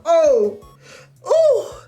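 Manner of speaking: high, loud "oh" squeals; two separate hammed-up whoops of delight; excited squeal. Streamer reacting to another angle, squealing in mock ecstasy.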